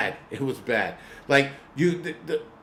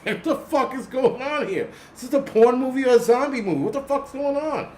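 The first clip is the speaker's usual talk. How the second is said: high-pitched, baffled disbelief; incredulous outrage; 11 semitones higher than usual. Retold or imitated. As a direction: replay your bewilderment in a much higher voice. Replays his shock at the scene.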